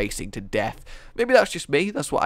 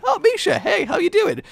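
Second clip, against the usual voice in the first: very high, cooing greeting; high sing-song hello to the bear; squeaky baby talk; 6 dB louder than usual. Pretend. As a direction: coo at the bear like a pet. Greeting a card.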